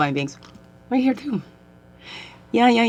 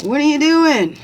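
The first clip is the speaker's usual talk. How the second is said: high, loud call across the street; a construction worker yelling from a truck, higher and louder; retold yell; 12 semitones higher, 7 dB louder than usual. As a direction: shout it at a passer-by. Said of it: "The guy yells out".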